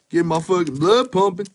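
ordinary talk, nothing marked

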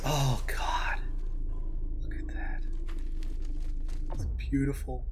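breathless whispered awe; awed whisper. Real, not acted. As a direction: whisper it in amazement. Awed by trailer visuals.

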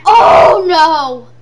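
real alarmed shout; panicked yell. Real, not acted. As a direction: scream it as they break in. Zombies attack, yelling.

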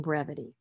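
BREVITY with a short "eh". Shown as a demonstration. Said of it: "with the short sound".

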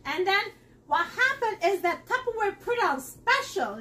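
ordinary delivery

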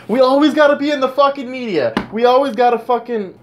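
building anger until you have to stop; getting pissed off. Real, not acted. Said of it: "I'm getting pissed off".

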